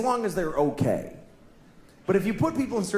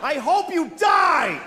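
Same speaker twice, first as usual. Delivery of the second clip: high, slow shout; shouted curse; 9 semitones higher, 3 dB louder, slower than usual. Retold or imitated. Driver shouting at another car, high slow shout. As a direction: wish death on him at full voice. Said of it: "I said that to a person".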